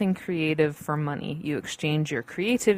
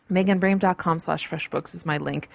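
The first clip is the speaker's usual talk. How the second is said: ordinary talk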